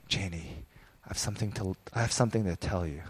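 a husband softly getting serious with his wife; serious and quiet. Retold or imitated. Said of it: "so serious".

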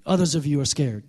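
ordinary talk

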